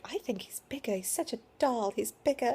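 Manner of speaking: a hint of a British midwife's accent. Retelling the British midwife's remark in a light British accent. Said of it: "so cute with her accent".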